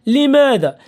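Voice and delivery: usual speaking voice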